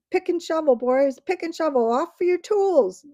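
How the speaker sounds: loud high dwarf battle cry; rallying shout. A narrator's reading. Dwarves shouting to each other to fetch tools, loud rally. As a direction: rouse the boys to their tools.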